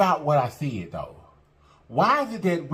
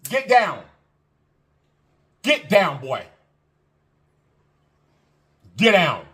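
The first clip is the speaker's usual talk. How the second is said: firm raised commands at the dog; calling the dog; 4 dB louder, slower than usual. Real, not acted. Owner ordering her dog Gucci down, firm and loud. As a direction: snap it out to get the dog down.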